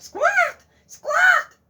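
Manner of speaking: high shrieking squawks; goose squawk. Acted. Goose squawking to wake the farmer, shrieking.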